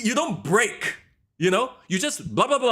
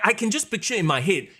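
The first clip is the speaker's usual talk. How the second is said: ordinary delivery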